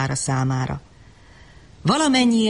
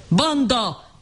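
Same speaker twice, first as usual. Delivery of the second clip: shouted insult; 7 semitones higher than usual. Acted. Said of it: "ordította".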